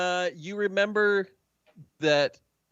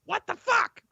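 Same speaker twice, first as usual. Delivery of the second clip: much higher, louder outrage; high outraged NPC; 4 dB louder than usual. Acted. GM voicing a tricked NPC, high and outraged. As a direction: wail at the ruined look. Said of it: "And he's like".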